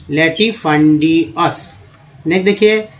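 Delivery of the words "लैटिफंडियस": लैटिफंडियस said slowly and clearly; slow sounding out. Shown as a demonstration. Teacher to students, slow clear pronunciation. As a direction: model लैटिफंडियस slowly again. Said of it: "teacher sounds out word".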